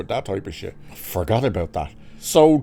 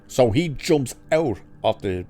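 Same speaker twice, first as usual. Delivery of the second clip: usual speaking voice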